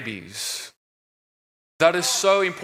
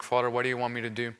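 softer and fast, a hushed rushed prayer; silent inner prayer; 4 semitones lower, 7 dB quieter, much faster than usual. Retold or imitated. Praying to the Father in his heart during deliverance, hushed and fast. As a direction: murmur it to yourself as if only in your heart. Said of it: "I, in my heart".

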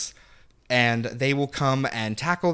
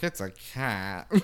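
dazed realization; 5 semitones lower, 8 dB quieter, much slower than usual. Retold or imitated. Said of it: "I'm like".

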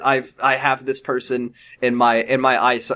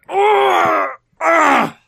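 straining grunt; 13 semitones higher than usual. Acted. Straining lifting the child.

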